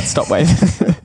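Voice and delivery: mock yell. Co-host joking about yelling at waving athletes, mock yell. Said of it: "you can yell out".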